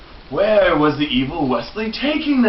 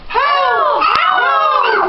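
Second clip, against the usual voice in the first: much higher mock cries of help; high princess cries for help. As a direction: call for help like a princess in distress. Princesses calling out.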